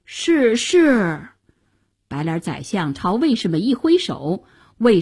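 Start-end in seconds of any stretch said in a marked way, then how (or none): 0.1-1.3 cowed, obliging yes-man; servile compliance. A narrator's reading. Minister obeying the armed hero, servile. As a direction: give in meekly and eagerly.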